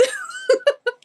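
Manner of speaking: a sudden high-pitched squeal of a laugh. Real, not acted. Laughing at her own snake joke, high squeal. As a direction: let a giggle jump way up high.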